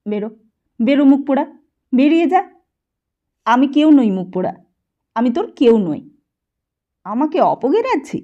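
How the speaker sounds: loud, high furious yelling; shouting old man. A narrator's reading. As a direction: drive the boy out with a yell. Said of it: "চিৎকার করে উঠেছে".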